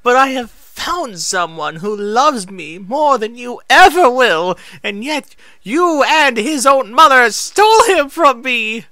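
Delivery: high, loud, grief-stricken cry; anguished high accusation. Acted. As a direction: hurl it at her through heartbreak. Moon Shadow's anguished accusation.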